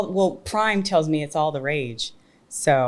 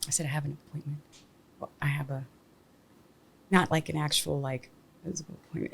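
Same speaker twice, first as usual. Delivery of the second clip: quiet; 7 dB quieter, faster than usual. Real, not acted. Clarifying quietly.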